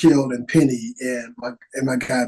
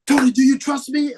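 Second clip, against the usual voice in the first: director's intense question; 10 semitones higher than usual. Retold or imitated. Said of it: "then he goes to".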